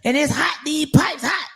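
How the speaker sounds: high-pitched agitated complaint, retold; mad as hell. Retelling her call to Juice about being stopped, mad as hell. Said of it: "I had called Juice mad as hell".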